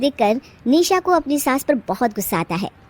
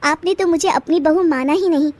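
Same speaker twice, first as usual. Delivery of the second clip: higher, bitter complaint; a young bride's higher voice, complaining to her mother-in-law; aggrieved young wife; 6 semitones higher than usual. Acted. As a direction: let out all the hurt. Bride airs her grievances.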